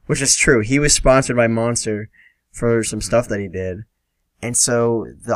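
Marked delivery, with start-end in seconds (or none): none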